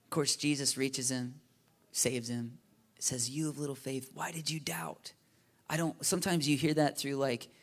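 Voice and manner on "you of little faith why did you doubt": softly voiced reproachful father; shaming father. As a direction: lightly shame them, well under your usual volume. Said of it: "a shaming father's voice".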